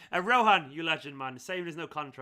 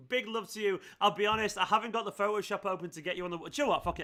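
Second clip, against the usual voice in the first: normal talking voice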